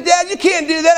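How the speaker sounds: high-pitched indignant daughter, imitated; child's protest. Daughter catching dad on the furniture, imitated protest. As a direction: re-enact a kid objecting to dad.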